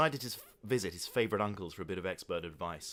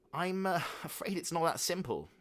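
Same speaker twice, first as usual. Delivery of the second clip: explaining father; 9 semitones higher than usual. A narrator's reading. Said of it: "explained Father David".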